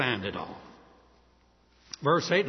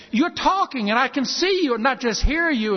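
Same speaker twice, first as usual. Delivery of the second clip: high, louder overjoyed exclamation; excited; 7 semitones higher, 6 dB louder than usual. Retold or imitated. Healed man to a helpful stranger, high excited outburst. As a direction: burst out with joy at seeing a face. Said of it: "the man's excited".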